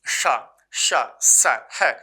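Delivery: शा शा drawn out very slowly as a hiss of breath, then स, है punched loud; hissing sha demo. Shown as a demonstration. Teacher to students with hands before mouths, hissing slowly. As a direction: hiss शा slowly so the air hits the hand. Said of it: "हाथ लगाओ अपना".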